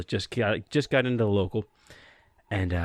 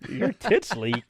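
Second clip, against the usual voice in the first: incredulous echo, breaking into laughter; baffled repeat; 8 semitones higher, slower than usual. Retold or imitated. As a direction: imitate an apprentice puzzling over the insult.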